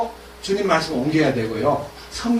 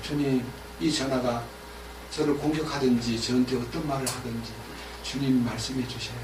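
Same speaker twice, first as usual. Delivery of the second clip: quiet prayer; 5 semitones lower, 10 dB quieter than usual. Acted. Preacher voices a quiet prayer.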